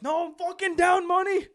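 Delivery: high, loud mock drunken wail; drunk roommate. Drunk roommate losing bets, high loud mock complaint. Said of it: "he was pissed drunk".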